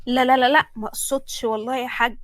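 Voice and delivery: loud, excited string of denials; hasty backtracking. Acted. Excited daughter reassuring offended father, hastily backtracking. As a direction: blurt it out loudly to take it back.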